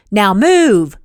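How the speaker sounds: loud barked command; sharp loud bark of an order. A narrator's reading. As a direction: snap her into action. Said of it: "he barked".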